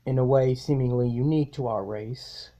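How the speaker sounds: measured, grave inner monologue; solemn self-narration. Acted. Villain narrating his own rebirth, grave and measured.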